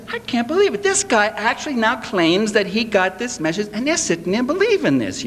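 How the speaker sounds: stunned, half-mocking amazement; recalling his shock at believers swallowing it; retold disbelief. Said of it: "I'm sitting there and saying".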